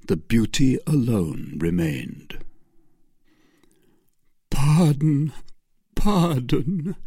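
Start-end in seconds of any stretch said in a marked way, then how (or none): 4.5-7.0 higher pleading cry; Felton's plea. Acted. Felton begging Milady for pardon. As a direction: beg her forgiveness. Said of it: "cried Felton".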